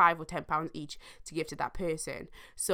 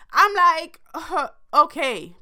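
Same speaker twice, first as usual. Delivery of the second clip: taken aback; 10 semitones higher, 8 dB louder, slower than usual. Retold or imitated. Reacting shocked to friend.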